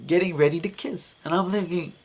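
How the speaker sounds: ordinary talk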